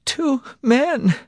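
very high, loud, tearful; sobbing helplessly. A narrator's reading. Wife against her husband's chest, sobbing. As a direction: force it out through sobs. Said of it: "sobbing helplessly".

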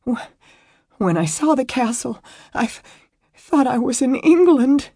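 high, halting tearful stammer; choked out. A narrator's reading. Weeping woman explaining her fright at the castle, choked up. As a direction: force the words out between sobs. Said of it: "she choked out".

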